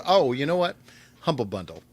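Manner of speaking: usual speaking voice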